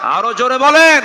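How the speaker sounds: high, full shout to the audience. Real, not acted. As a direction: shout for a bigger response. Repeats demand, much louder. (চিৎকার করে জোরে বলার তাগিদ)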